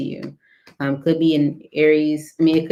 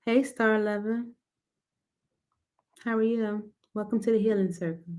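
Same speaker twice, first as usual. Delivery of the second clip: soft, higher-pitched hint of Southern; slight Southern accent; 4 semitones higher, 4 dB quieter than usual. Real, not acted. Greeting a viewer to the Healing Circle in a light Southern accent. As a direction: slip lightly into a Southern lilt. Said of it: "a little Southern accent".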